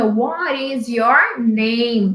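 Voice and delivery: very slow, each word separated; slow model question. Shown as a demonstration. Teacher modelling the question slowly for pupils. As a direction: spell it out slowly for learners. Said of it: "Por isso que a pergunta é".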